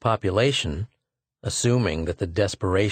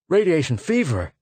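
startled higher-pitched question; alarmed cry; 7 semitones higher than usual. A narrator's reading. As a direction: cry it out in disbelief. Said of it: "cried the lieutenant".

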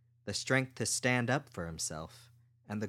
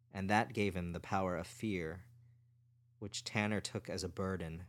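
plain reading voice

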